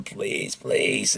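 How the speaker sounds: soft whispered begging; weak pleading whisper. Retold or imitated. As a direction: barely breathe the words, pleading. Dying gremlin begging weakly.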